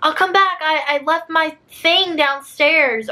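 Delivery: high-pitched, drawn-out fib; made-up excuse. Retold or imitated. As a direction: act out a hasty excuse to get away. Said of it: "making up some dumb excuse".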